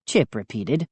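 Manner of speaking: plain reading voice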